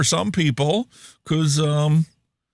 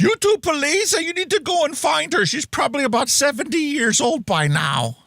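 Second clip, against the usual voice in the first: high squeaky voice; 12 semitones higher than usual. Pretend. Mock high voice.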